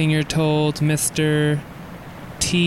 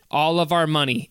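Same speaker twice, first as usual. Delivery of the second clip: usual speaking voice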